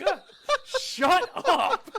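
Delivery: high, laughing "shut up"; giggling protest at a friend. Real, not acted. To his cohost after the joke, laughing.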